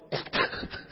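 muffled, hammed-up sobbing; mock bawling. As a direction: imitate quiet helpless blubbering, no words. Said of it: "I'm bawling".

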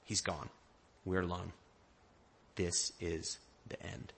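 despairing. Retold or imitated. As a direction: say it as if all hope is gone.

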